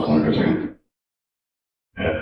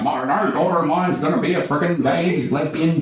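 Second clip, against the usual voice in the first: mock outraged father, pitched up; higher, put-on parental outrage; disapproving dad; 8 semitones higher than usual. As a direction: imitate an indignant dad laying down the law. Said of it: "lot of dads who'd be like".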